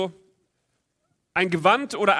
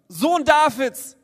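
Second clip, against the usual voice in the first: schreien; 5 semitones higher, 4 dB louder, slower than usual. A narrator's reading. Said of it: "schrie nur noch lauter".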